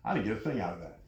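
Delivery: low, soft, sour mock of the complainer; mocking mimic. Preacher mimicking the unmoved listener, low mocking murmur. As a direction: mimic the complaint, muttered and dismissive. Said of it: "mocking the unmoved listener".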